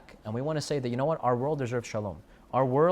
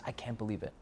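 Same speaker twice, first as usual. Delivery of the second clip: softer, tearful hush on "I can't believe it"; 4 semitones lower, 12 dB quieter than usual. Retold or imitated. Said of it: "And she starts bawling".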